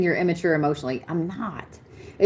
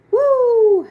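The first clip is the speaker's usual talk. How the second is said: long, high, loud "woo"; sustained high "woo" that drops at the end; whoop; 6 dB louder than usual. Real, not acted. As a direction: let out a drawn-out high whoop. Exclaims at the reading.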